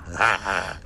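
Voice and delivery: short tipsy chortle; gleeful chuckle. A narrator's reading. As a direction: chuckle contentedly like a happy drunk. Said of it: "gluckste der Dreiecksgott vergnügt". (vergnügtes, betrunkenes Glucksen)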